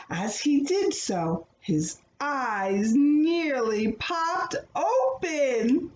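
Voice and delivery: usual speaking voice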